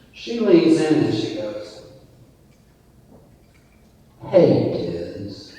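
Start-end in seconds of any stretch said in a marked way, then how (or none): 4.3-5.5 low, seductive greeting; Monica's voice. Retold or imitated. Monica leaning in to greet Dizzy at the bar, low and alluring. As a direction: drop the voice and lean in with allure. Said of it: "and with that voice".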